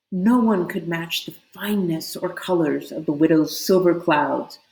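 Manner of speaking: usual speaking voice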